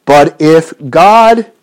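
slow, deliberate reading with long pauses; slow careful reread. Shown as a demonstration. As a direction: read it again slowly, weighing each word. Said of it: "I'll read that again".

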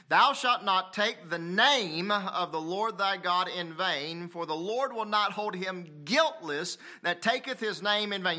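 high, ringing scripture recitation; preaching scripture. Acted. Preacher quoting the commandment to the church, proclaimed scripture.

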